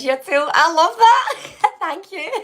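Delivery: high, loud laugh through the words; delighted giggle. Real, not acted. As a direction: burst out laughing with joy. Reacting delighted to a viewer.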